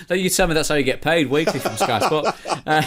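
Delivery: amused tease, laughter in the voice; laughing. Real, not acted. Presenter teasing the pundit about his pay, laughing. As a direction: talk through a laugh.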